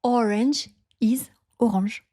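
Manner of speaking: English ORANGE first, then French "o-ranzh"; English word, then its French pronunciation. Shown as a demonstration. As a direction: contrast the two, second ORANGE with a French nasal AN. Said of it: "you pronounce it en français".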